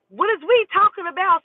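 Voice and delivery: high, loud, mocking disbelief; indignant retort. Replying to the boyfriend's text, high loud mock outrage. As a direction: throw his words back at him, outraged. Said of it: "And I'm like".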